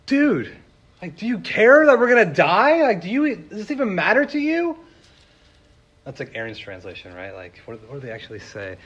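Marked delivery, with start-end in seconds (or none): 0.1-4.8 high, loud panicked pleading; frantic cry. Retold or imitated. Disciples frantically waking sleeping Jesus, panicked shouting. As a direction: shake him awake, yelling in panic. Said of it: "they come up to Jesus frantically".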